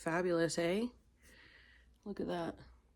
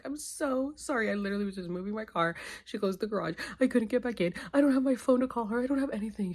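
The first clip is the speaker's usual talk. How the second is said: mildly frantic, higher-pitched explaining; retold pleading apology; 5 semitones higher, faster than usual. Explaining the lockout to the roommate, mildly frantic retelling. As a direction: act out a flustered, pleading excuse.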